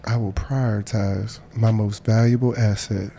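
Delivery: calm steady affirmation voice; guided meditation. Acted.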